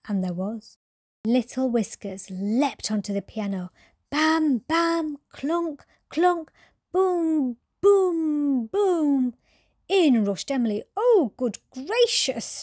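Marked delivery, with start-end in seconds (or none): 4.1-9.3 high "bam, clunk, boom" in a row; piano noises. Retold or imitated. Sound effect of the kitten playing the piano, high and loud. 11.0-12.6 high, slow astonished "oh, good gracious"; gasped. Acted. Emily finding the kitten at the piano, gasping high. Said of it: "she gasped".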